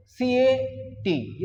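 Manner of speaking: slow letter-by-letter spelling for the class; spelled slowly. Shown as a demonstration. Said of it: "इसको हम बोलते हैं कैट". (अक्षर-अक्षर धीरे बोलना)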